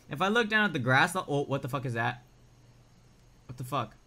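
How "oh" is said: ordinary talk, nothing marked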